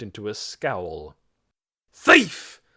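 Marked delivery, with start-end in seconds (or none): 1.9-2.6 loud, high accusing shout. A narrator's reading. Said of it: "he shouted".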